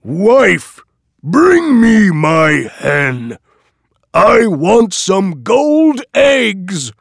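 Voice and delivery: loud, slow, booming giant voice; dopey cartoon bellow. A narrator's reading. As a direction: bellow it like a dim-witted giant. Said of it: "the giant says".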